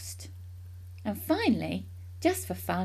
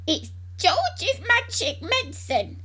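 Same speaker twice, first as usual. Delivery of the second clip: high, slow, drawn-out holler; grandma's shout; 12 semitones higher, 4 dB louder, slower than usual. A narrator's reading. Grandma explaining from the rooftop, shouting. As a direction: shout each word slowly in a high old voice. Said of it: "Grandma shouted".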